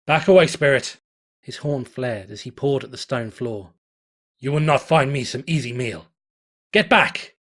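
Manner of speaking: loud, defiant threat; angry warning. Acted. Stallion shouts defiance at the spirit, loud and angry. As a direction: drive off a monster with threats.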